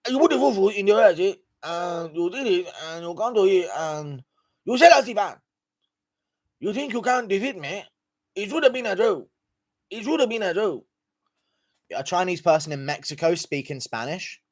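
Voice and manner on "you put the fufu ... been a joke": high-pitched mock Nigerian accent; Lagos accent. Shown as a demonstration. Showing a requested accent to the stream, hammed-up Lagos accent. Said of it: "Lagos accent".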